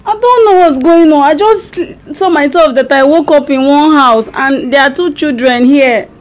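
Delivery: puzzled stranger in someone's house; amnesiac confusion. Pretend. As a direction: sound lost and unsure. Wife fakes amnesia prank call.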